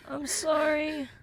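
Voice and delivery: ordinary talk, nothing marked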